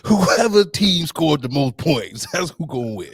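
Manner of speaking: slow, hammy pundit voice, laughing; mock a pundit, slow and grand; relaxed sports commentary. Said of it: "I love when they say that".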